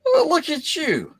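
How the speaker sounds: high, stretched out fond exclamation; excited squeal. Real, not acted. Host cooing over a friend's figure, high delighted squeal. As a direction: coo at it like it's adorable.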